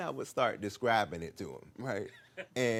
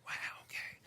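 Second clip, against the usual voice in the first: whisper; 9 dB quieter than usual. Retold or imitated.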